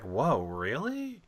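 drawn-out, slow mock astonishment; re-enacted incredulous reaction; retold surprise. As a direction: stretch it out with a swooping questioning rise. Retelling own surprised reaction live.

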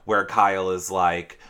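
ordinary talk, nothing marked

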